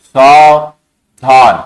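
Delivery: सावधान stretched very slowly, syllable by syllable; drawn-out demo word. Shown as a demonstration. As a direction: draw out सावधान slowly and firmly for the class. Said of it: "इसमें देखना पड़ेगा".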